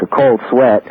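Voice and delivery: ordinary talk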